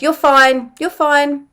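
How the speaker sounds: put-on a parent briskly waving away a child's tears; mock-cheery parent hushing a hurt kid; dismissive parent. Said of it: "it's likely that you were told".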